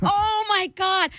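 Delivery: high, slow, horrified oh my God; drawn-out shocked oh my God; mortified gasp. Retold or imitated. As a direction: caught in the men's room, aghast. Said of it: "And I'm like".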